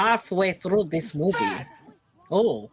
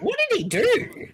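mock high voice; 13 semitones higher, slower than usual. Repeating the question mockingly.

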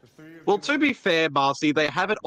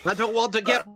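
put-on Scottish accent; 9 semitones higher, 4 dB louder than usual. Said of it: "talking with a Scottish accent".

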